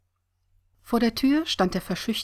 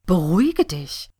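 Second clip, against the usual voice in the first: acted outraged echo of "beruhige dich"; indignant ranting; 6 dB louder than usual. A narrator's reading. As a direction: throw "beruhige dich" back, louder, rising in disbelief. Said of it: "wetterte sie".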